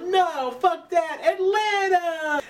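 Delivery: very high mock yell, last word drawn out; acted gleeful yell. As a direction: imitate an overexcited kid yelling. Kid brushes off warning, cheering.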